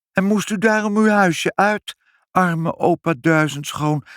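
put-on high-pitched, eager little child; child's voice. A narrator's reading. Child offers the old man her bed, high and earnest. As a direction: pity him, then invite him in, high and childlike. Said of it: "zei Ibbeltje".

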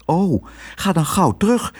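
put-on high, urgent child voice; concerned girl. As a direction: urge him kindly to hurry. Girl urges the dog back.

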